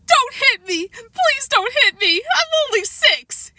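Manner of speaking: put-on high, drawn-out little-boy wail; hammed-up child crying, very high. A narrator's reading. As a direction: plead through acted tears. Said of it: "Daniel started to cry".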